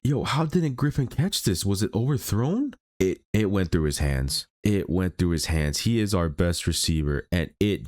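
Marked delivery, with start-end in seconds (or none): 0.0-2.7 high, incredulous outburst at the dropped pass; can't believe what you just saw, pitch jumping up; shocked gamer reacting to a fumble; stunned disbelief. Real, not acted. Receiver drops an easy catch.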